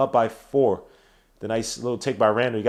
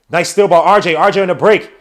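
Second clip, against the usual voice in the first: loud, raised call of a fast break; urgent play-by-play; 4 semitones higher, 9 dB louder than usual. Real, not acted. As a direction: yell instructions at the player on the break. Fast break call going wrong.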